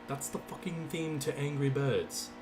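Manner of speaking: put-on slow, hammy British accent; badly faked British drawl. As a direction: attempt a British accent and miss. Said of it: "I can't do a good British accent".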